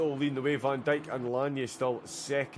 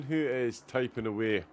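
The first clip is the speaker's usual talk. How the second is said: ordinary delivery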